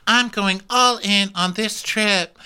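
high, slow, drawn-out impression; hammed-up high-pitched announcement; put-on cast member voice. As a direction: stretch it out in a high voice. Acted quote of a cast member.

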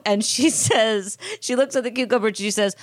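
ordinary delivery

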